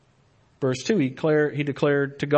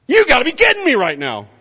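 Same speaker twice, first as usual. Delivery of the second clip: loud, high exasperated cry; fed-up disbelief, let it burst out; exasperated spouse; 11 semitones higher, 8 dB louder, faster than usual. Acted. Spouse finds partner napping, loud exasperation. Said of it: "your wife or your husband coming in and going".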